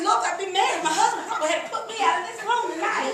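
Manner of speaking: high, loud, fast laughing rant; giggling retelling of her outburst; laughing exclamation. Retold or imitated. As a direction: laugh through the complaint. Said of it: "I said".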